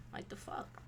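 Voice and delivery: breathy whispered aside. Real, not acted. Muttering about inconsiderate people, whispered. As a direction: whisper it under your breath.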